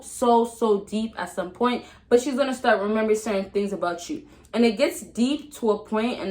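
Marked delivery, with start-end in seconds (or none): none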